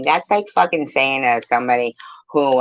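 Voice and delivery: normal talking voice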